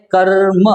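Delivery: कर्म with the र held long before the म; drawn-out pronunciation. Shown as a demonstration. Teacher sounding out a word for pupils, कर्म stretched on the र. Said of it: "अब हम बोल रहे हैं".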